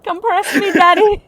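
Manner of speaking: high, pleading mock whine; high whiny plea. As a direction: beg like a whiny child.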